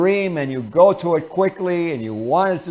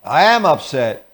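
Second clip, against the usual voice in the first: a louder, terse "Yeah"; mild antagonism; 4 dB louder, much slower than usual. Acted. Partner rising to antagonism, a touch louder. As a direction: snap back, a little irritated. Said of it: "They're in antagonism now".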